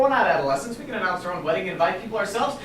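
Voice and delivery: ordinary delivery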